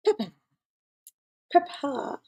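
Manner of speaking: lightly imitated guest's "Papa"; imitating Alvin. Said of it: "that's the way he says it".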